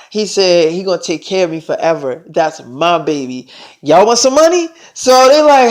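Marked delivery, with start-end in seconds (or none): 3.8-4.7 high, teasing question to the group; excited offer. Retold or imitated. Selena offers her friends money, excited offer. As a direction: dangle the offer, voice pitched up.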